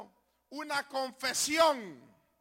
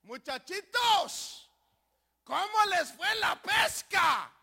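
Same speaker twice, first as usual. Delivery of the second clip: high, slow shouted question; loud, drawn-out hail to the group; calling out; 6 semitones higher, 6 dB louder, slower than usual. Acted. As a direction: holler it slowly as if from the shore. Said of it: "les hace una pregunta".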